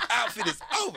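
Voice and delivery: high, laughing punchline. Real, not acted. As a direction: laugh the line out.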